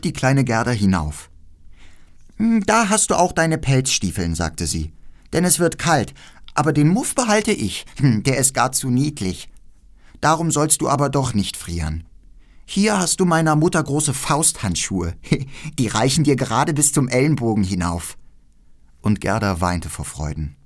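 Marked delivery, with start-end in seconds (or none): 2.4-4.3 hohe, lebhafte Mädchenstimme; freches Räubermädchen. Acted. Voices the robber girl. 5.3-18.2 hohe, lebhafte Mädchenstimme; freches Räubermädchen. Acted. Voices the robber girl.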